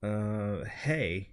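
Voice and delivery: low, quiet, offhand hey; curt Jim voice. Acted. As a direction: mumble a reluctant greeting. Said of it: "I'll be Jim".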